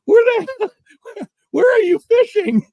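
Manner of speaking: high, loud, slow plea breaking into laughter; retold incredulous outburst, stretched out; tearful exasperation. Storyteller demands where the partner is fishing, exasperated. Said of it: "I got tears in my eyes".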